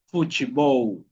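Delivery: "Futebol" slow, fu-chi-bou; Portuguese pronunciation. Shown as a demonstration. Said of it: "sería futebol". ("Futebol" lento, pronunciado fu-chi-bou)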